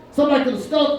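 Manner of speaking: ordinary delivery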